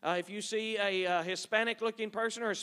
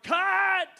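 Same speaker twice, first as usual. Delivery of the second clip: sharp, high, loud shout of one word; film director yelling cut; hollered; 11 semitones higher, 10 dB louder than usual. Retold or imitated. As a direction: bark the order to stop filming. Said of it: "That guy hollered".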